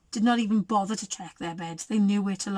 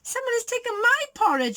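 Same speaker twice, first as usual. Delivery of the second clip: higher-pitched Mama Bear voice; medium-sized voice; 13 semitones higher, faster than usual. A narrator's reading. Mama Bear finds her porridge gone, medium-sized voice. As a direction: raise it into a mother bear's voice. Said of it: "in her medium-sized voice".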